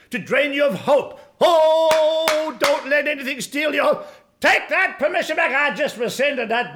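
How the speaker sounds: high, loud exhorting cry; impassioned preaching. Acted. Preacher tells congregation to protect their hope, impassioned preaching. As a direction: cry out, urging them to fight back.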